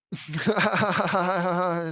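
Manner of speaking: long, rapid-fire fake chuckle; acted chuckle. Reader voicing Luann's laugh at the pun, hammed-up chuckle. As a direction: chuckle on and on in character. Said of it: "and chuckling and clicking her remote".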